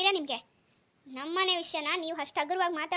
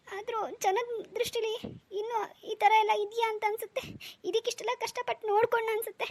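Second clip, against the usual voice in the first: higher, breaking voice through tears; tearful hurt; 4 semitones higher than usual. Acted. Stepmother to her husband, crying over the insult. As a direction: pour out the hurt while crying. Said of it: "ನೀವು ಅಳೋದ್ ನಿಲ್ಸಿ".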